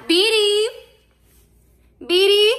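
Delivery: loud, high call across the house. Real, not acted. Calling her little girl. (llamando a la niña en voz alta y aguda)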